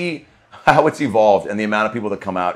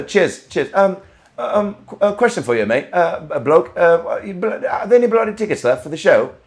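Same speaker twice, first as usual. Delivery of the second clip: higher, hammed-up British bloke accent; mock British accent; 7 semitones higher than usual. Comedian asks fans about tickets in a mock British accent. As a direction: play a cheeky British bloke asking for tickets. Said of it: "is that British?".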